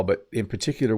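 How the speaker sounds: ordinary talk, nothing marked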